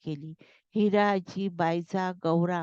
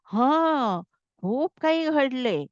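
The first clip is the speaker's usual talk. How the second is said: higher-pitched, impulsive blurt; lightly acted, let it slip out suddenly; 6 semitones higher, 3 dB louder, slower than usual. A narrator's reading. Mahadev answering the grandmother's question, blurting it out. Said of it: "तो एकदम बोलून गेला".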